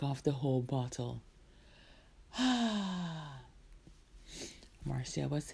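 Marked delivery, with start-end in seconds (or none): 2.3-3.4 long falling "ah" of satisfaction; satisfied sigh. Acted. Marcia finishing the whole bottle, satisfied sigh. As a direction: exhale after draining a drink.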